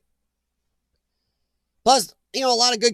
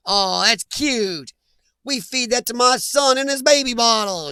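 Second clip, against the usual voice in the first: put-on a German accent that slides into Southern, very slow at first; mock a German sneering at Budweiser with a wobbly German-turned-Southern accent; botched German accent; 3 semitones higher than usual. German mocking an American's Budweiser, botched German accent. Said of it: "why do I have a Southern accent for a German".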